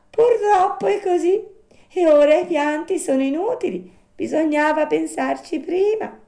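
high little-animal voice; reproving marmot. Acted. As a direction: scold regretfully in a tiny high voice.

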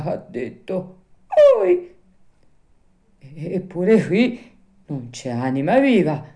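put-on shaky frightened old man, half to himself; trembling fear. A narrator's reading. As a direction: stammer it out, scared stiff. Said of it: "cominciò a dir tremando e balbettando". (tremante e balbettante dalla paura)

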